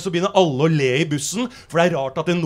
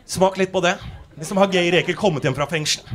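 ordinary talk, nothing marked

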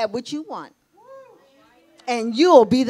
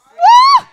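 sudden high shouted woo; loud joyful whoop; 14 dB louder than usual. Real, not acted. Preacher whooping to the congregation, loud and high. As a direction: let out a huge whoop of release. Shout after surviving anger.

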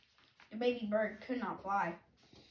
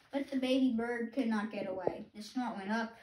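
plain reading voice

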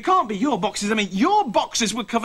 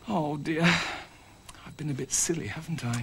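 low, soft, sheepish admission; contrite; 7 semitones lower, 8 dB quieter than usual. Acted. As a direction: own up quietly and regretfully. Matthew admits he was wrong.

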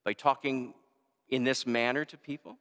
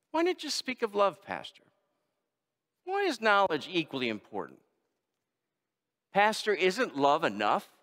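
higher voice, lightly playing a puzzled churchgoer; curious questioner; 6 semitones higher than usual. Pastor voicing a listener who questions him, in a higher, puzzled tone. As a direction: ask it like a doubting listener. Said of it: "one might ask".

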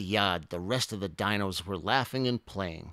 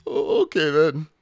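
loud, high, halting character voice; mildly hammed-up dejection; hurt giving in; 8 semitones higher, 11 dB louder than usual. A narrator's reading. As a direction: stammer it out in defeat, high. Rejected Minosaur gives up.

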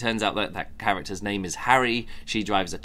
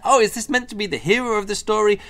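higher, disbelieving question; incredulous; 9 semitones higher, faster than usual. Retold or imitated. Reviewer doubting the stalkerish hero, higher and incredulous. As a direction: sound baffled at this hero. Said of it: "I'm just like".

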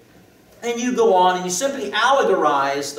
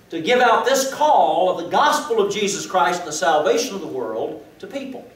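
higher, louder, rising sermon voice; fervent preaching; 3 semitones higher, 4 dB louder than usual. Acted. Preacher telling the congregation of the call, fervent. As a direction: preach it out with mounting fervor.

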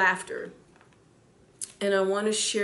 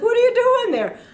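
startled outcry; 13 semitones higher, 10 dB louder than usual. Retold or imitated. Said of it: "It's like".

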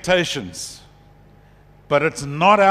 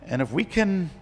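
normal talking voice

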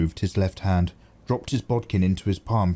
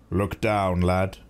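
plain reading voice

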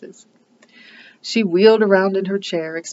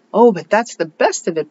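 a mild protest, lightly raised; 2 semitones higher, 6 dB louder than usual. A narrator's reading. Anne mildly protesting to Mrs Lynde. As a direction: push back gently but eagerly. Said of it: "protested Anne".

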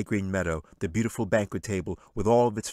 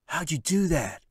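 put-on a higher boy voice, wondering; amazed boy; 9 semitones higher, 3 dB louder than usual. A narrator's reading. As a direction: ask in awe.

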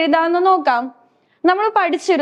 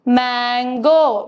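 Mango said very slowly and lower; slow teaching model; 4 semitones lower, much slower than usual. Shown as a demonstration. Teacher slowly naming the mango for her pupils. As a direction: stretch the word out for children. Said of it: "ആം എന്താണ്?".

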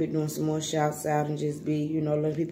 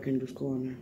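ordinary delivery

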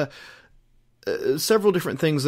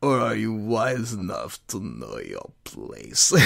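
put-on low, slow, breathy sinister old man hiss; menacing low whisper of a dark lord; Emperor voice; 3 semitones lower, 4 dB quieter than usual. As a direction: purr it out like a scheming old emperor. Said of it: "I can't help doing the voice".

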